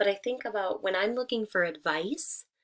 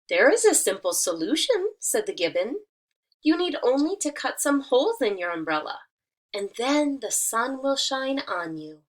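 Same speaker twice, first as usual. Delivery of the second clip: lively, louder storybook reading; reading to children; 6 dB louder than usual. Acted. Teacher reading a fable to her students, lively storytelling. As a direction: read it out brightly for the class.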